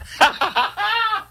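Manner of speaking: a quick real laugh; high laugh. Real, not acted. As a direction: burst of high-pitched laughter. Laughing at his bad impression.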